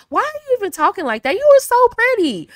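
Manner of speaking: high, fast, disbelieving scold; incredulous scolding. Retold or imitated. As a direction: ask in high disbelief why they talk like that.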